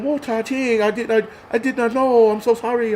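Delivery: high, mimicked Chinese-accented apology; mock Chinese accent. Ranter mimicking Chinese people apologizing, mock Chinese accent.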